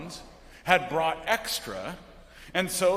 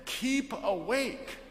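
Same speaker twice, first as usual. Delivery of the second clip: slow emphasis; 6 semitones higher, slower than usual. Shown as a demonstration. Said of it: "the focus is on that one thing".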